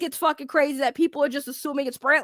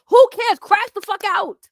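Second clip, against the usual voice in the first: loud and high, fed up; exasperated shout; 5 semitones higher, 6 dB louder than usual. Real, not acted. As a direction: throw it out like a shout. Ranting about Keem's calm.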